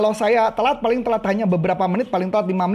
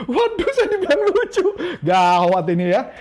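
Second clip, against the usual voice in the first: laughing; 5 semitones higher than usual. Real, not acted. Laughs at being called funny.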